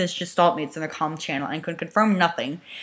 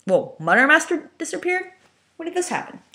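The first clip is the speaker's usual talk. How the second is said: much higher, startled reaction; surprised aside; 12 semitones higher than usual. Real, not acted. Reader reacting to the twist.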